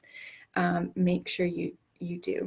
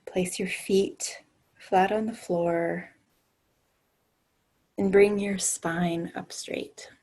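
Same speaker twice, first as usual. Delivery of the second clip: guided meditation. Acted. Leads a grounding meditation.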